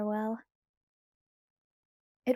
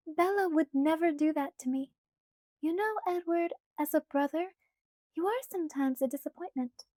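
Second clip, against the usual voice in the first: put-on light, higher girlish pleading voice; Alice's voice; 6 semitones higher than usual. A narrator's reading. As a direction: voice Alice higher, coaxing Bella.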